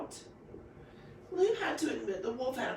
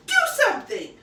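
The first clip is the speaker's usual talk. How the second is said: high, very loud yell; 12 dB louder than usual. A narrator's reading. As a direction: demand it, shouting. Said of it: "the girl yelled at Lou".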